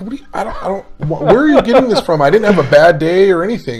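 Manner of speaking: high, loud, sputtering disbelief; acting out bewildered indignation; baffled protest. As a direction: stammer into a hammed-up defensive protest. Retelling his protest to his wife.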